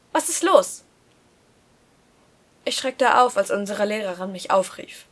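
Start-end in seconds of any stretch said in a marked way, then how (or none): 0.1-0.8 higher teacher voice calling out; teacher interrupts the daydreaming boy. Acted. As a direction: snap him out of it as the teacher. Teacher calls on him.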